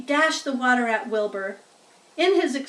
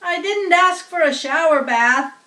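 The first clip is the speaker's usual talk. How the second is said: higher, louder irritated scolding; 5 semitones higher, 8 dB louder than usual. A narrator's reading. Soaked farmer scolds the hired man, irritated. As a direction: scold the clumsy helper. Zuckerman retorting.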